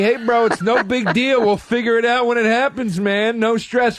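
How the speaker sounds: sing-song stoner slacker; pothead. Retold or imitated. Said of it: "I don't care for your potheady".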